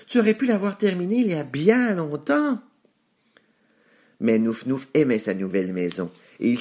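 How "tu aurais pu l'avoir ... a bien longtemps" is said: mocking piglets, pitched very high. A narrator's reading. Lazy brothers teasing the one who took a week, mocking. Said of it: "se moquèrent de lui".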